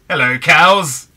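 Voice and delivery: loud, drawn-out greeting; calling out. Pretend. Gamer greeting a herd of cows in Minecraft, playful loud call. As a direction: hail them loudly and slowly.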